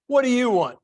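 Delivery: curt, loud rude waitress snapping. Retold or imitated. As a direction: bark it at the customer. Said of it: "She'd come out and go".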